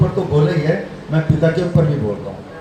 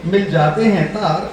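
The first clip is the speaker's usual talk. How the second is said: higher, lilting verse recitation; shayari recital; 6 semitones higher than usual. Real, not acted. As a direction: declaim the couplet to a mushaira audience.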